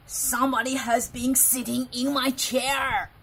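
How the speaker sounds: loud, big-voiced papa bear growl; Father Bear growls. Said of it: "Father Bear growled in his great big voice".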